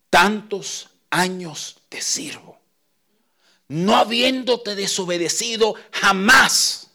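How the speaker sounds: slow, whispered then rising to a high strained protest; resentful son, whisper swelling to a cry; older son's complaint. Acted.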